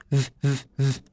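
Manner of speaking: ف voiced, teeth on lip; buzzing V. Shown as a demonstration. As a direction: hold ف as a humming V. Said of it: "بتحط كده أسنانك على شفتك". (انطق «ف» V والأسنان على الشفة)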